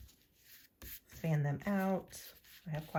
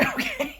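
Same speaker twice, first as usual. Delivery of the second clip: high, sudden real laugh of shock; burst of laughter; 5 dB louder than usual. Real, not acted. Reacting to a tough card draw, a high shocked laugh. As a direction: laugh out loud, caught off guard.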